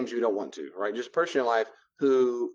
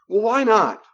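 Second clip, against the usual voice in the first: high, loud indignant why not; boundary buster; 9 semitones higher, 8 dB louder, slower than usual. Acted. Friend pushing back on a declined invitation, loud and indignant. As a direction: demand it like a pushy friend. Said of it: "no matter how kind you say it".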